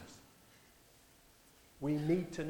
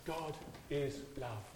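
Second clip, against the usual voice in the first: softer and slow, stress on is; tender reprise; 8 dB quieter, slower than usual. Acted. Preacher repeats God is love to the church, tender. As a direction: say it quietly with wonder.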